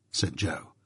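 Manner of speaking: plain reading voice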